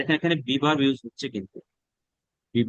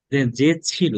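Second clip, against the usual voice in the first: normal talking voice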